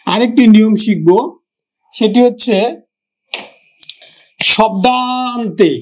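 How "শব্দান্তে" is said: শব্দান্তে stretched out very slowly; slow teaching word. Shown as a demonstration. Teacher to students, spelling out শব্দান্তে very slowly. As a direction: draw out শব্দান্তে syllable by syllable. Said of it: "মানে শব্দের অন্তে".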